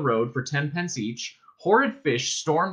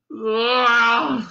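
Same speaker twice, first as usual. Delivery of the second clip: loud, high drawn-out groan; queasy groan; 10 semitones higher, 6 dB louder than usual. A narrator's reading. Arthur feeling his body bend, queasy groan. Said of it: "said Arthur as he felt his body softening".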